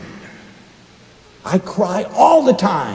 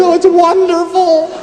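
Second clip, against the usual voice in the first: laughing through tears; 5 dB louder than usual. Real, not acted. Sobbing over book, answering host.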